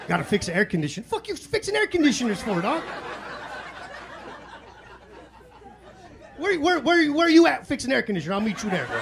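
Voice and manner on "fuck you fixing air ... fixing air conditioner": pleading dealer to come back, high; high indignant rant. Retold or imitated. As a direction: demand where he is, incredulous. Chasing the dealer down.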